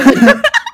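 sudden bright burst of laughter; loud high laugh. Real, not acted. Laughing at own segue.

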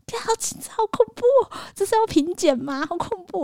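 high, squealing mock panic. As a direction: squeak out your fake terror. Panicking over the buyer's inspection.

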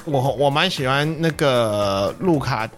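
normal talking voice

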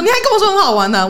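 high-pitched mock scolding with laughter; angry principal. Principal scolding an honest pupil, mock-furious. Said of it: "他就生气说".

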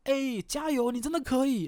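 high, bright mock encouragement; cheery coach. Trainer cheers mid-workout, high mock-cheerful encouragement. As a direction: cheer someone on through a hard set. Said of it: "健身教练很喜欢在那个中途的时候说".

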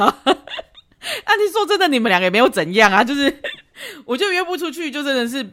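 high-pitched laughing through the words. Real, not acted. Advice host laughing at a rejected dater. Laughs at listener's dating woe.

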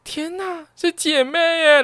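sister's high-pitched gleeful shriek through laughter; delighted squeal. Retold or imitated. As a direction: squeal it with giggling joy. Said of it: "然后就开始狂笑".

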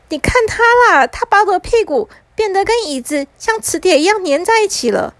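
put-on high-pitched whiny little boy; complaining little brother. Little brother complaining to mom about the magician's trick, high and indignant. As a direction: tattle in a high child's voice. 弟弟向妈妈告状.

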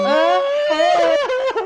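high, loud shriek of laughter. Real, not acted. Friend hearing the creepy line, loud shrieking laugh.

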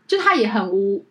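usual speaking voice

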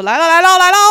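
loud, high mounting alert; imitated excited cue. Friend cueing singers at karaoke, loud excitement. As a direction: call the moment as it arrives.

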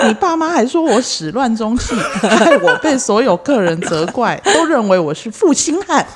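loud, high indignant tirade; indignant rant. Acted. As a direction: scold her, building outrage.

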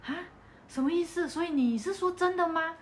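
is this real?; stunned disbelief. Retold or imitated. Retold reaction to the child news.

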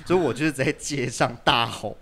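laughing while telling it; 对 said through a laugh; chuckling through the story. Real, not acted. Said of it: "嗯。".

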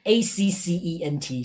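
slow letter-by-letter spelling. Shown as a demonstration. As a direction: spell it softly for the learner, one letter at a time. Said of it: "先知道accent". (放慢，逐个字母拼读)

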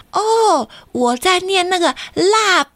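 put-on high, eager boy voice; excited boy. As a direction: jump at the offer like a thrilled kid. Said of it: "健太就说".

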